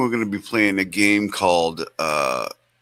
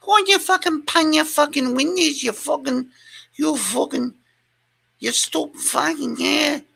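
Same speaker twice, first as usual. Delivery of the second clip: very high-pitched garbled cursing; Nicola's voice clip. Retold or imitated. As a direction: parrot her clip in a shrill high voice. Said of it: "I'll get voice clips from the Nicola, like".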